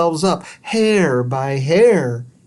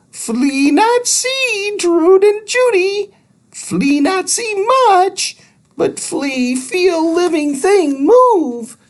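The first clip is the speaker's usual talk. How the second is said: very high, slow squeaky alien voice; tiny squeaky creature; slower than usual. Acted. Thud explains the flea in a high squeak. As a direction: squeak each broken word slowly.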